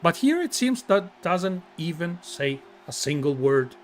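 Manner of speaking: measured, hushed introduction with long pauses; mock-solemn announcer. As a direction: introduce the fighter with reverent gravity.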